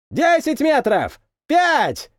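high shouted count, last word loudest; shouting countdown. A narrator's reading. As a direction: call the final metres over the roar. Countdown to touchdown.

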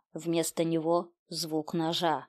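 ordinary delivery, nothing marked

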